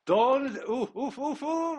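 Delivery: high, loud strained oofs; tense high groans. Real, not acted. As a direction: wince through the last play. Tense final play.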